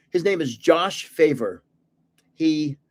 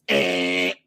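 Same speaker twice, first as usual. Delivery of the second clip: low, deep mock buzzer noise; playful wrong-answer buzz to a kid; 10 semitones lower than usual. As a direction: drop into a low game-show buzz. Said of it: "and I go".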